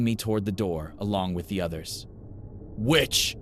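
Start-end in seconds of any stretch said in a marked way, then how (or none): 2.8-3.4 spat accusation. A narrator's reading. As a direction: spit out the word Witch! with rising venom. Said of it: "Gracie spat in my face".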